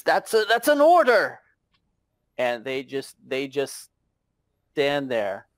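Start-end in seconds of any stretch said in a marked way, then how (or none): 0.0-1.4 higher, louder, stumbling command; insistent sergeant. Acted. Young sergeant to guards who ignore him, insisting loudly. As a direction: pull rank on men who won't move. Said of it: "and he says".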